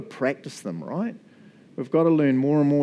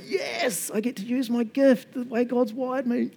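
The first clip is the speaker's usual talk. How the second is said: higher, excited helper voice; happy helper, higher pitched; mock eager joy; 8 semitones higher than usual. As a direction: sound thrilled to be asked. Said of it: "they're like".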